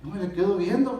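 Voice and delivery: usual speaking voice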